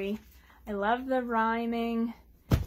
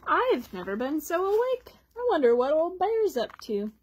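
bright duck voice; 5 semitones higher, 4 dB louder than usual. Acted. Duck says it.

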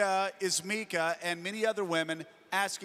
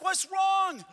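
high, raised-voice "what is it"; alarmed retold exclamation; 9 semitones higher than usual. Retelling his reaction to crying.

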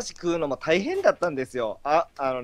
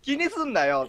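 mock comforting; 12 semitones higher than usual. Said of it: "って".

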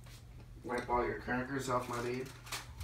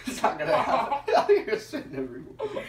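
talking through laughter, pitched up; giggling while teasing; laughing; 10 semitones higher, 6 dB louder than usual. Real, not acted. Laughing at friend's cracker struggle.

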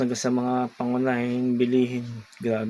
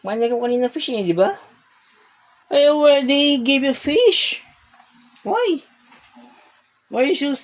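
high, louder cooing to a pet; 10 semitones higher, 5 dB louder than usual. Real, not acted. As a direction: coo at the sulking cat. Baby talk to sad cat. (malambing na baby talk sa pusa)

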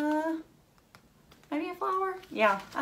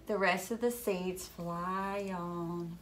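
usual speaking voice

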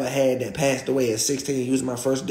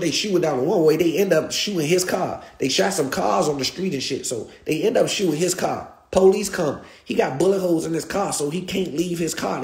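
ordinary talk

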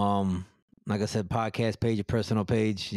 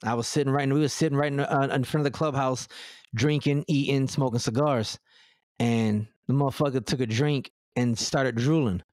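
usual speaking voice

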